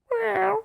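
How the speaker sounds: high, soft imitated meow; cat meow. Shown as a demonstration. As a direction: mew like a cat at the door. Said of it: "I go".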